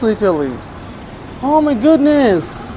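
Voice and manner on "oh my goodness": high, slow exclamation; drawn-out delighted surprise; amazed. Real, not acted. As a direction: marvel aloud, pitched way up. Amazed puppy is eating rice.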